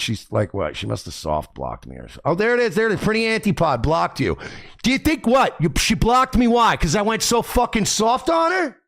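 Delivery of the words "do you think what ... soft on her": high-pitched incredulous questioning; indignant disbelief. Real, not acted. Streamer protesting that she blocked him, indignant disbelief. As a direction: demand a reason, pitch jumping up.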